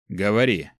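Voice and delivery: curt, mildly displeased command; displeased order. A narrator's reading. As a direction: order it with restrained irritation. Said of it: "недовольно произнёс". (сдержанно недовольный приказ)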